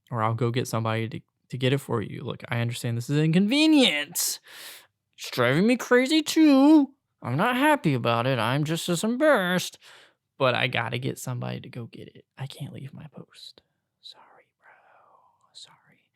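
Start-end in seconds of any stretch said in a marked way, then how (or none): 2.2-9.8 exasperated plea. Retold or imitated. Retelling own plea to upset customer. 12.4-16.0 very soft, fading to a whisper; whispered, helpless apology; hushed apology. Retold or imitated. Retold apology, dropping to a whisper.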